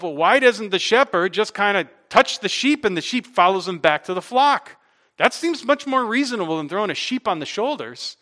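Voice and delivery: loud, high, driving sermon voice; preaching at full force. Acted. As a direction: preach it hard to the congregation.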